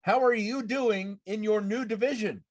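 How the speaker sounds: ordinary talk, nothing marked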